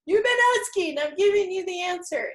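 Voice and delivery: high, loud, emphatic voice of the body; the body answering. Retold or imitated. Listener's body answering her question, high and loud. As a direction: announce it like your body just found the answer. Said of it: "and your body goes".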